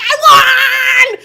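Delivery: high, very loud, drawn-out victory scream; excited child's shout. Retold or imitated. Mom imitating her daughter announcing her win, excited screaming. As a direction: shriek it out like an overjoyed kid. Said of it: "she was so excited".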